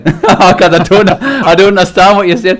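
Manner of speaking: helpless, loud laughing delivery. Real, not acted. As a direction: crack up while protesting. Laughing while retelling failing to understand.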